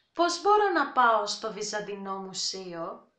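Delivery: Πώς μπορώ να πάω στο Βυζαντινό Μουσείο? at brisk native speed; quick everyday pace; fast natural take. Shown as a demonstration.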